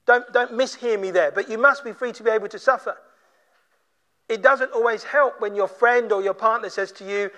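ordinary talk, nothing marked

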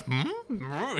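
high questioning hum; a puzzled "hmm?" in light imitation; lightly mimicked pundit's hum; Tucker Carlson. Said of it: "like, the Tucker Carlson face".

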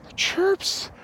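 high, breathy-sounding mock delight over birdsong; dreamy human. Humans marvelling at birds, in a high gushing voice. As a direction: gush like a charmed nature lover. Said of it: "you're like".

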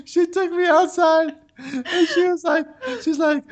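very high, slow, squeezed by real laughter; cracking up. Real, not acted. Storyteller recalls being taken outside by teacher, cracking up. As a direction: struggle to tell it through laughter.

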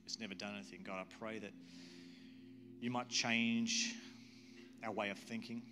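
earnest congregational prayer with long pauses; leading prayer. Real, not acted. Pastor praying to God for the congregation, earnest prayer. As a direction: pray aloud in measured, unhurried phrases.

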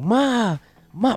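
mock yell. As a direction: imitate yelling for your mother without real volume. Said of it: "So I start yelling".

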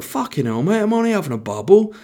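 high-pitched mock lad voice; mock protest. Acted protest of bubble guy.

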